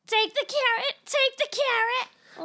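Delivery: high, loud rabbit puppet chant. Acted. Rabbit puppets urging someone on, high and loud. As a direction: squeal it out like pushy puppet rabbits. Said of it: "three rabbit puppets are there".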